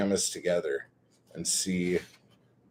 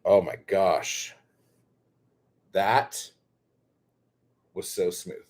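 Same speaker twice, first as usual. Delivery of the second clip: normal talking voice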